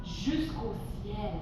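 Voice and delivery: low, slow and soft; hushed awe. Pretend. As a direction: drop to a drawn-out, wondering hush. Beanstalk reaching up to the sky.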